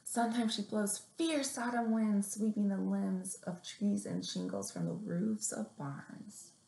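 gentle picture-book read-aloud; story reading. Acted. Librarian reading a picture book to little kids, gently.

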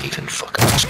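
whispered over the mic; hushed unvoiced whisper. Real, not acted. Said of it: "why are you whispering".

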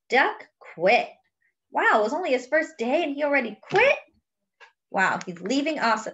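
plain reading voice

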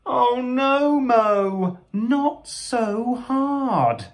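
high, drawn-out dismay; storybook alarm, high and slow; dismayed cry. Acted. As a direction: wail it high, stretching the last words. Friends' dismay at Mo's mishap.

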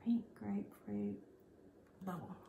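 quiet whispered preview; whispering. Real, not acted. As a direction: breathe the name softly. Whispered preview before reveal.

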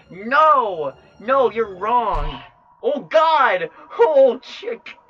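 loud high screams, the middle one drawn out slowly; anguished screaming. Acted. Character crying out in horror at the scene, screaming. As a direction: scream it out as if it's tearing you apart.

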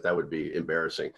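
ordinary delivery

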